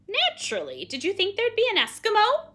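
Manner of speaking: loud scoff, rising high; sarcastic retort. Acted. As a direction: scoff loudly at a silly question. Penelope scoffs at silly question.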